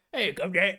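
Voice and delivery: hammed-up Cockney shout; mock Cockney. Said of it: "It was just Cockney English".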